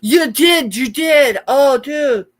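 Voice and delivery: real excited outburst; excited reaction. Real, not acted. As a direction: exclaim it, thrilled at the news. Reacting to Myra's llama sticker news.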